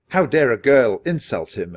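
a touch of a jeering yell; mild yell. A narrator's reading. Second boy yelling at Annie, mild angry yell. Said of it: "another yelled".

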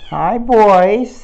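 cheerful loud hello to the birds; calling out. Real, not acted. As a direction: call across the room to the birds.

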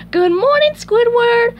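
very high, loud greeting; SpongeBob warbling. Acted. Popping up next to his co-worker, high loud warble. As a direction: warble it like a piercing sung hello. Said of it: "SpongeBob warbled".

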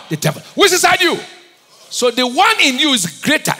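loud, high call and response; full-force preaching. Acted. Preacher shouting questions at the congregation, loud and high. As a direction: fire the questions at the crowd.

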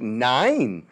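lightly voiced German dictator refusing; mock German Hitler. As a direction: say "Nine" like nein with smug German defiance. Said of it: "Hitler's like".

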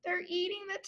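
high gasp then hushed alarm; shocked gasp. A narrator's reading. Reader gasping as dragons eat the tacos. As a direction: gasp in horror, then say it softly.

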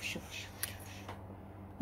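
real whisper, trailing away; whispered urging. Real, not acted. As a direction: whisper it, repeating the word as it fades. Seller aside urging a look.

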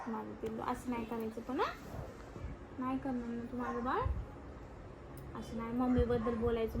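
soft, tender motherly scolding; tender coaxing. Real, not acted. Mother softly scolding her sulking little daughter. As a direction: gently coax her to say sorry.

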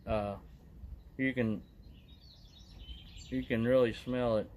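talking around pipe stem; pipe in mouth. Real, not acted. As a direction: lips clenched on pipe. Seen 4: Pipe stem held between lips throughout; out of mouth before and after.

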